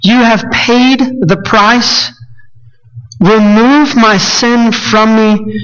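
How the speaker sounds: slow, high, earnest prayer; prayerful plea. Retold or imitated. A believer praying to Jesus, slow earnest plea. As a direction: pray it slowly and lift each phrase. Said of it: "and we say".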